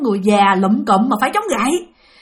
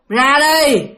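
raised, drawn-out command; retold yell; 2 semitones higher, 5 dB louder than usual. Woman orders hiding children out, retold yell. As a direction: call them out like the menacing woman. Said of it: "Bà la lên".

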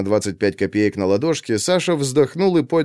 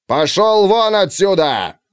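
slow, raised, high yell; 7 semitones higher, 3 dB louder, slower than usual. A narrator's reading. Colonel yells the captain out, moderately raised. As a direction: bark him out of the room. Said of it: "Брагин всё же не выдержал, заорал".

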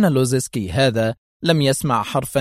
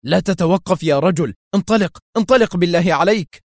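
urgent shout; 6 semitones higher, 4 dB louder than usual. Acted. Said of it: "هاتفا بالروسية".

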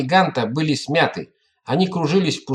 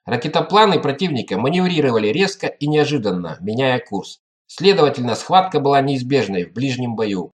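ordinary delivery, nothing marked

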